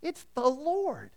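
high, slow, awed realization; John leaning over to tell Peter, high awed excitement; excited discovery. Retold or imitated. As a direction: let the wonder rise as you recognize him. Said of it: "he leans over to Peter and says".

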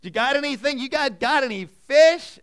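high, loud teasing call from the shore; Jesus teasing the fishermen from the shore, loud folksy holler; folksy shout-out. Retold or imitated. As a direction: holler at them like a buddy ribbing tired fishermen. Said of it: "The phrasing is great".

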